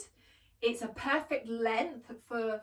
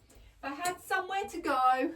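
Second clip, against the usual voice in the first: dream aloud about a night out; lifted, yearning repeat; wistful dreamy sigh; 8 semitones higher, 4 dB louder than usual. Acted. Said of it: "Oh, if I had somewhere to go".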